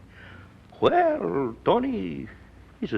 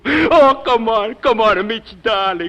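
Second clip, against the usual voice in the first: overjoyed greeting; 8 semitones higher, 5 dB louder, slower than usual. Acted. Tony overjoyed to see Midge.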